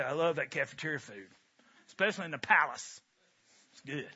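a hint of a relaxed friend shooting the breeze; easygoing small talk. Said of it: "So what does Nathan do?".

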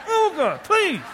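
high strained plea with a laugh; hammed-up desperate please; mock straining plea. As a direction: strain it out like constipation. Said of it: "It's like constipation".

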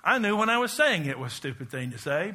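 usual speaking voice

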